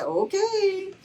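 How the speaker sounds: high-pitched retold okay; reluctant agreement. Daughter gives in to mom's order to dance, high-pitched okay. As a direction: go along with it, voice shooting way up. Retold hesitant reply to mother.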